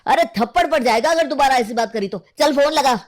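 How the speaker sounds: angry scolding. Acted. Threatens a slap, orders a call. (गुस्से में डाँटते हुए)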